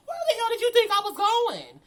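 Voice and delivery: high, loud angry yell; mom yelling. Retold or imitated. Mom scolding her daughter about the party, loud angry yell. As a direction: yell it like a furious mother.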